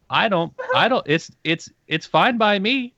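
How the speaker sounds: usual speaking voice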